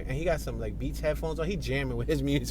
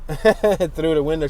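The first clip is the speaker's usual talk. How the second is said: laughing through the words; 9 dB louder, slower than usual. Real, not acted. Driver laughing at a woman eating inside. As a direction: giggle while saying it.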